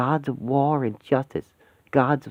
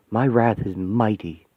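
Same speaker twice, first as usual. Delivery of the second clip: normal talking voice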